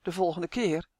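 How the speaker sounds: usual speaking voice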